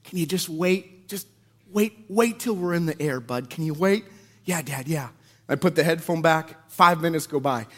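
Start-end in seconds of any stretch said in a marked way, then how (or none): 0.0-4.0 hushed. Retold or imitated. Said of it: "just open one flap of his ear". 4.4-5.1 quiet reply. Retold or imitated. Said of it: "I go".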